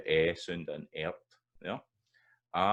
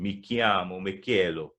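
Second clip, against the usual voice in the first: Italian lilt, louder, regular stresses; Italian rhythm; 6 dB louder than usual. Shown as a demonstration. Teacher giving an Italian example, Italian regular rhythm. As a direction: say it in bouncy Italian with an even beat. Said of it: "there's a regular rhythm in that".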